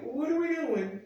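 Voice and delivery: high, drawn-out resignation; exasperated. Acted. Viewer giving up on the show's plot, exasperated. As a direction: give up in a high, stretched-out voice. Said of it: "I'm like".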